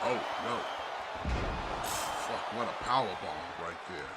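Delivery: excited live play-by-play; wrestling commentator. Acted. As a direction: call the match live. Live play-by-play of ladder match.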